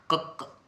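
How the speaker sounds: क whispered as a bare sound with no vowel; whispered demo. Shown as a demonstration. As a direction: try to say क without any vowel, whispering it. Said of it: "कैसे ही कर लो".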